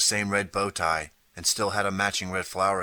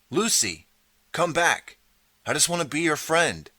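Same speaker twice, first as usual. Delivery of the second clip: much higher, louder pleading call; calling after her; 9 semitones higher, 4 dB louder than usual. Acted. Calling after slammed door.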